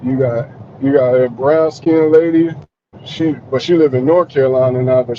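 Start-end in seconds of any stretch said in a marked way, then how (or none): none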